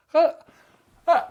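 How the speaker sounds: very high, strained protest; straining to demonstrate. Real, not acted. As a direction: talk while showing your tongue. Demonstrating how far tongue comes out.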